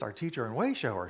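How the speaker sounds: usual speaking voice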